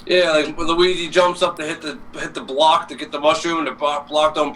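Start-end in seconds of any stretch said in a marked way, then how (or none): none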